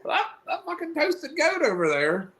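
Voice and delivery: high squeaky mocking voice; gossiping onlooker. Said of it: "I don't want somebody going".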